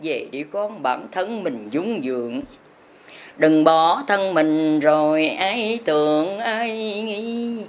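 normal talking voice

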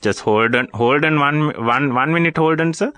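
usual speaking voice

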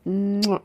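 drawn-out smacking kiss; kiss sound. Acted. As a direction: plant a long noisy "mwah". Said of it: "ನಾನು ಮುತ್ತು ಕೊಟ್ರೆ ಸಾಕು".

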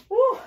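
high loud whoop of relief; excited whoop. Real, not acted. Cheering herself after finishing the warm-up, high loud whoop. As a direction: let out a big joyful woo.

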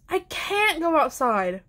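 put-on high-pitched protest from a child. A narrator's reading. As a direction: object to mother, pitch lifted. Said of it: "protested Flora".

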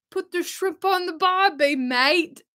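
put-on a cheesy tourist's Australian accent. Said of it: "She's an Aussie".

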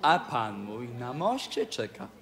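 low, playful purr-like self-introduction; purring cat intro. Acted. Cat introduces himself to the king. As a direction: roll the cat names like a purr.

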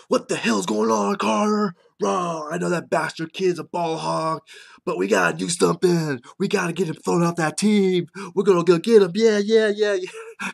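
loud, high, riled-up roar; hammed-up shouting, building to frantic yeahs; booming angry dad. Dad matching his son's rage, booming. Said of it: "he came in with voice booming".

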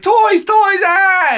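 very high mock toddler squeal; screaming excited child. Small son begging dad at garage sale, imitated shrieking child. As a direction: imitate a kid shrieking with desire.

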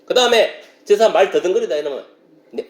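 normal talking voice

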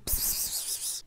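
unvoiced psst calls; whispered psst. Gamer hissing psst to get an NPC's attention. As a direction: hiss to catch someone's ear.

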